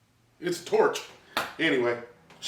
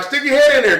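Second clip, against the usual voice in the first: taunting dare; 6 semitones higher, 6 dB louder than usual. Retold or imitated. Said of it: "I'm like".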